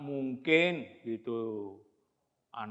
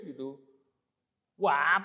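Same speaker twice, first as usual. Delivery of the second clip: ordinary delivery, nothing marked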